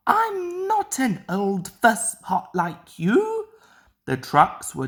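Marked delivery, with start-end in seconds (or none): 0.1-3.5 higher, cheeky jab; snippy retort. A narrator's reading. Mavis sniping back at Toby. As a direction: tease him with a higher voice. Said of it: "she replied".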